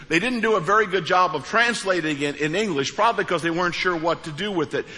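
usual speaking voice

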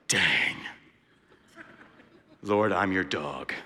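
low, slow, softened admission; humbled realization. Retold or imitated. New believer answering the Lord, humbled and low. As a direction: let it sink in slowly and quietly.

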